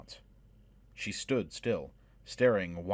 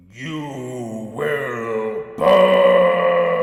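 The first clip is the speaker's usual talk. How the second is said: huge drawn-out roaring dragon voice, much higher and louder; dragon threat; 6 semitones higher, 14 dB louder, much slower than usual. A narrator's reading. Dragon vowing to burn the fleeing heroes, roaring. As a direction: roar the threat, stretching burn.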